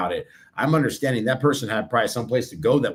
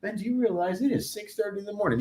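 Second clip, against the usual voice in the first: much softer, higher, baffled mumbling voice of the others; grumpy coworkers; 6 semitones higher, 7 dB quieter than usual. Retold or imitated. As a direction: grumble it like half-awake colleagues. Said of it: "everybody in there's like".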